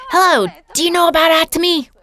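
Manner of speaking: high, quick, too-loud greeting. Retold or imitated. Insecure seller walking into a new store, asking loudly. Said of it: "with a loud voice ask".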